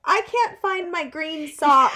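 high, loud, drawn-out mock whine; pestering kid. Kid outside bathroom door to crying mom, loud slow whine. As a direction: drag it out like a needy child.

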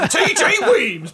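high, loud and slow through laughs; laughing shout. Acted. Joining the film death joke, laughing loud yell. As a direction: yell the name while cracking up.

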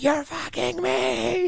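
long, high drawn-out wail; mock meltdown, slow and high; near breakdown. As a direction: stretch it into a hammed-up desperate whine. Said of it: "like, almost not a breakdown".

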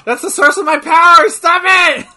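high, loud hammed-up whine; mock protest. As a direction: whine like a teased wrestler. Mock protest as wrestler is teased.

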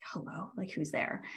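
a hushed, put-out hello, breathy at the end; affronted. Retold or imitated. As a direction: mimic the host sounding affronted at a late knock. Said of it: "what I was perceiving is affronted".

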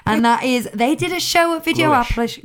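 ordinary talk

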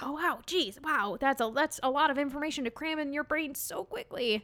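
lightly hammed-up astonishment; mock amazement. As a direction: act impressed by the doctor. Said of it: "And I'm like".

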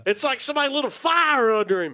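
high race caller, laughing; a Harry Carey style track call; horse race announcer. Pretend. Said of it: "your horse track guy is like Harry Carey".

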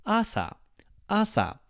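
asa, first A up high, SA dropping down; high-low asa. Shown as a demonstration. Teacher showing morning: asa starting high, falling low. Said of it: "Start higher and go lower".

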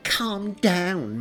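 high, loud mocking echo; exaggerated, jeering repeat. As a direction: throw the words back at him mockingly. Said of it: "her voice mocking".

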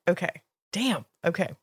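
usual speaking voice

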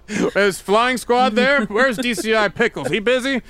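high, hammed-up British caller; British accent. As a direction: ask for the police in mock British. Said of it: "but in a British accent".

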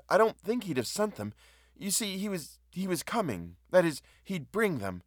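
high, hesitant woman explaining herself; flustered woman. Acted.

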